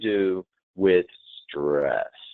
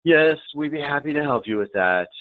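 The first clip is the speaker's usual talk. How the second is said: marked inflection; 5 semitones higher than usual. Shown as a demonstration. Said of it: "the inflection can change the entire meaning".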